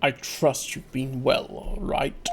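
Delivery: low, slow, lightly voiced composed prosecutor; a hint of a cool, measured Edgeworth; Edgeworth impression. Larry greeting Phoenix as Edgeworth, low and slow. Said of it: "a pretty good Mister Edgeworth impression".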